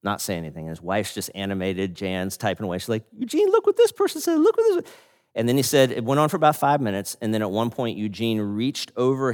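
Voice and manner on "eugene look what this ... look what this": squeaky, much higher, delighted little voice; a high, bright "oh, cool" from a delighted wife; sweet old wife. Retold or imitated. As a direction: pitch way up as a sweet excited old lady. Jan excitedly reading online comments.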